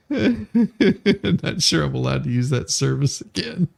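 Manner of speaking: words squeezed out through laughter; giggling at her own joke; laughing mid-sentence. Real, not acted. Joke about OnlyFans foot pics.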